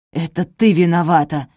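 loud, accusing hiss; angry hiss. A narrator's reading. Stepmother blames Sophie for the shoes, hissing. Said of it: "прошипела Араминта".

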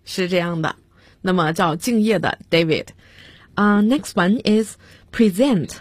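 ordinary talk, nothing marked

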